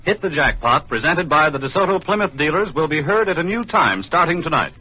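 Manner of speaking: normal talking voice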